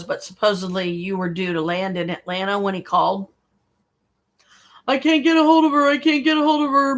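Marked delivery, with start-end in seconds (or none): 4.9-5.9 higher, louder mock-panicked repeat; retelling a friend's anxious phone call, panicky; freaking out. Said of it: "he's freaking out". 5.9-7.0 higher, mock-panicked repeat; retelling a friend's anxious phone call, panicky; freaking out. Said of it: "he's freaking out".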